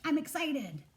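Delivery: teacher demoing an exclamation point; excited. Shown as a demonstration. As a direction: show the kids how an exclamation sounds. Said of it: "That's like".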